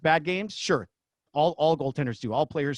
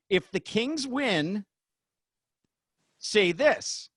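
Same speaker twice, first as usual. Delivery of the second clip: slow, higher script read-out; 4 semitones higher, much slower than usual. Retold or imitated. Announcer reading both script versions, slow. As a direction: read each line like a cue card. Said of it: "two versions of the script".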